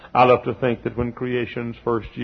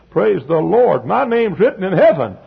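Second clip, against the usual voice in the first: higher, louder rising praise; preacher's spell; 8 semitones higher, 6 dB louder than usual. Real, not acted. Preacher feeling a spell come over him, rising praise. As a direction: let the spirit take you. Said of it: "I feel a spell coming on".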